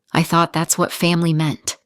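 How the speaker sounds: plain reading voice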